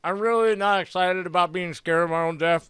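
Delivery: talking through a mouthful of taco; mouth full. Acted. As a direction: say it while chewing food. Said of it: "between mouthfuls".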